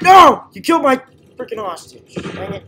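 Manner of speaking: loud high protest fading; outraged cry. Real, not acted. Player whose hostage was shot protests loudly. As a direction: cry out at the loss.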